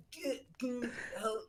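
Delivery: high, soft struggling to get words out; mock choking over a swollen tongue. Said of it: "She can't even speak because her tongue is so swollen".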